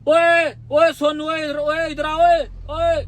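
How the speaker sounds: high, loud rowdy calling out, a bit slow; rowdy guy yelling a name across the lane; loud crude guy. Retold or imitated. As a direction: mimic a loudmouth hollering across the street. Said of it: "उसके साथ ऐसा इंसान".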